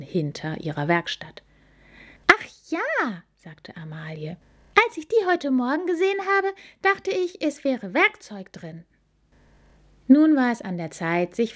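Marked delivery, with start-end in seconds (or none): 2.3-8.8 put-on high, quick childlike voice; young wild boar girl. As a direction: play the cheerful little piglet. Amalie figure voice, high. (hohe Kinderstimme)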